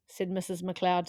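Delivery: plain reading voice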